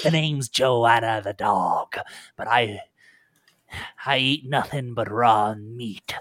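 feral pirate killer introducing herself; snarling dog-woman pirate. Acted.

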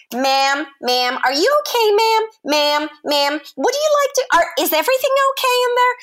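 high, urgent stewardess calling through the door; worried stewardess. Retold or imitated. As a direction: call in anxiously through a toilet door. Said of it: "knocks on the door".

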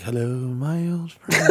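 soft, slow, drawn-out croon; softly half-sung hello; mock tender greeting. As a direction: greet a long-lost love in a hushed voice. Greets breadsticks tenderly, quoting song.

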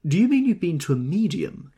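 high, teasing mock question; humorous. A narrator's reading. Jordan teasing Tom about a medium, playful. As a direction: tease him with the question. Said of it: "inquired Jordan humorously".

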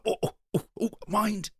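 high, loud little cries of pain; pained yelps. Acted. Clerk yelping as his corns get bumped, high and loud. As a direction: yelp as your sore foot is knocked.

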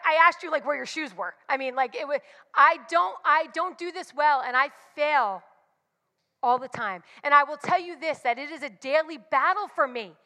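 ordinary delivery

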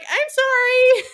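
very high, slow, loud sorry; squeaky apology. Retold or imitated. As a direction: squeak it out mortified. Louisa broke his arm.

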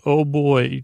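ordinary delivery

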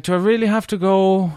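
high, hammed-up whine; mock crying plea. Boyfriend begging girlfriend to skip wedding, mock crying plea. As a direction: plead like a put-upon child. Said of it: "So I cried and I was like".

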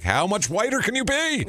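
high-pitched, incredulous mock outburst; mock exasperation. Mock outburst at a fancy handkerchief.

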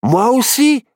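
high, loud, gleeful cub voice; merry high-pitched character voice; amused little bear. A narrator's reading. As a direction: crow with delight at the discovery. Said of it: "rigola Barnabé Ourson".

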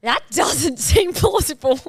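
laughing through a sceptical echo; laughing mock echo. Friend echoes the sceptical line while laughing. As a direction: repeat the line cracking up. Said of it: "And then I was like".

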